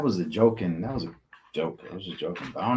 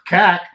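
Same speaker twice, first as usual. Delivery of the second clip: loud, high-pitched "cack"; imitated clack; 9 semitones higher, 11 dB louder than usual. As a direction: mimic the stick jamming with a sharp "cack". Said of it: "It makes that sound".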